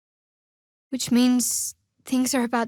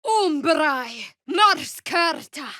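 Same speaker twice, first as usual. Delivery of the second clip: spell incantation; 5 semitones higher, much slower than usual. Acted. Casting a spell aloud.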